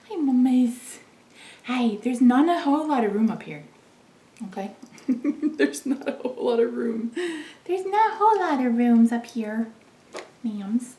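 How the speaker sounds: sweet pet talk. Real, not acted. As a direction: coo at the cats. Talking to the cats.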